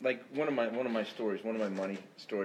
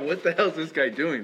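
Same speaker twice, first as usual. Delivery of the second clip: loud, fast baffled exclamation; incredulous; 6 semitones higher, 8 dB louder than usual. Retold or imitated. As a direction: blurt it out in disbelief. Father-in-law's baffled reaction.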